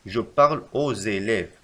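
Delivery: slow, careful correction; slow dictation. Shown as a demonstration. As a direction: dictate the correct form slowly. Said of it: "يجب علينا أن نكتب".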